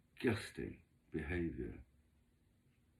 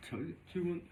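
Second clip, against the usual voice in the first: usual speaking voice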